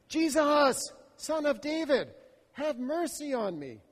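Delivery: high pleading cry, fading in level; crying out again. Acted. Blind man cries to Jesus again, pleading. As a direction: call again, begging.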